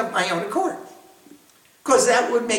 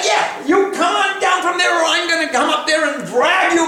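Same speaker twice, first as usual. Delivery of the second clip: mock threat; 6 semitones higher than usual.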